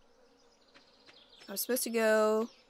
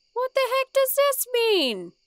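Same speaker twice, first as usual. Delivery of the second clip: high incredulous shout; 10 dB louder than usual. Real, not acted. Confused by grandpa's note.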